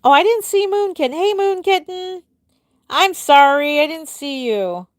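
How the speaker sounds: high, loud startled oops; surprised apology. Real, not acted. Greeting a viewer she missed, high startled apology. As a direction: catch yourself and apologize brightly.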